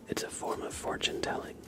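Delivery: usual speaking voice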